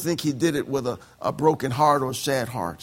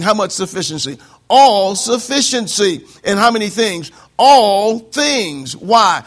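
loud, emphatic call; rising preaching; 5 semitones higher, 8 dB louder than usual. Real, not acted. Preacher answering his own questions to the congregation. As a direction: build each answer higher.

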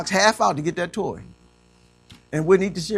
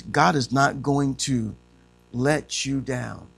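usual speaking voice

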